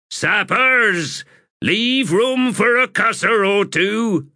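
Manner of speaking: high-pitched, drawn-out command. Acted. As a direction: call the order out to the whole crew. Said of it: "raised his voice".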